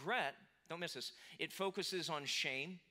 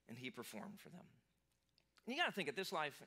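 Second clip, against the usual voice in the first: ordinary talk, nothing marked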